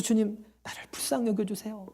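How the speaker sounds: hushed, whispered plea for mercy; 속삭이는 기도. Shown as a demonstration. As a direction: pray it softly, mostly on the breath. (속삭이듯 간절한 기도)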